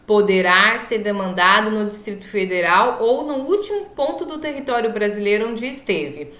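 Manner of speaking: ordinary delivery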